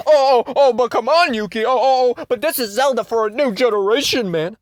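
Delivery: high, loud whiny fanboy voice; high squeaky mock fan; mocking fake fans. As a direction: parody defensive fans pleading. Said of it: "fake Zelda fans going".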